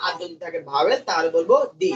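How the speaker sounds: normal talking voice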